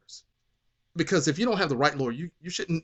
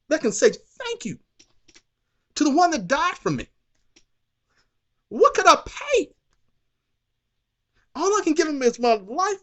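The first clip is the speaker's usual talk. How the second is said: high, loud preaching shout; preaching at full force; 4 dB louder than usual. Real, not acted. Preacher shouting grace to the congregation, full force. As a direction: let the praise ring out.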